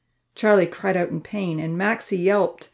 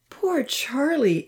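slow, high-pitched lament; drawn-out, pitched-up sorrow for a friend; mournful; 8 semitones higher, much slower than usual. A narrator's reading. Amy pities Charlie after the boys' raid, mournful. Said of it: "Amy mourned".

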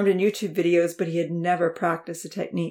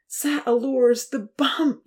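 higher, dismayed French exclamation; cried out; 6 semitones higher, slower than usual. A narrator's reading. Professor realising his bump caused it, dismayed cry. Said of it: "Jax cried out".

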